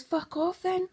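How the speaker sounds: high-pitched, tossed-off brush-off; retold dismissal. Woman telling Pelly to go, high dismissive retort.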